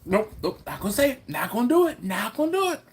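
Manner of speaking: hammed-up, climbing refusals, bursting to tell; acted high-pitched holding back; excited self-restraint. Acting out holding back the secret.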